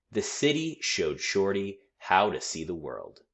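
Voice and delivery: ordinary delivery, nothing marked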